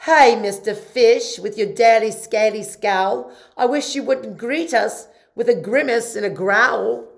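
loud, lively jellyfish; a floaty jellyfish scolding. Acted. Jellyfish speaks.

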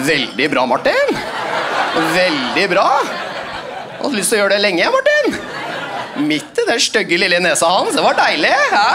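a hint of a gleeful colleague, rising on the name; teacher praising him in secret. Impressed teacher grinning at the new substitute. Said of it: "så gjør han læreren sånn".

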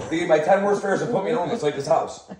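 ordinary talk

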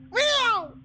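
high mock meow; cat yowl imitation. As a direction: yowl like a cat being yanked away.

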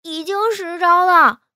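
high, loud, drawn-out whine; tearful loud complaint, pitched high; nearly crying. A narrator's reading. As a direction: wail it slowly, close to tears. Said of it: "金陵都要哭了".

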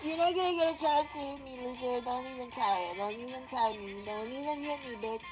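normal talking voice

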